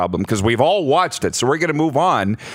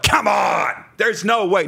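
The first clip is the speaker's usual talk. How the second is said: mock incredulous cry, pitched up; mock disbelief; 5 semitones higher, slower than usual. Imitating city people who won't believe it, mock disbelief. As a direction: imitate scoffing disbelief with a raised voice.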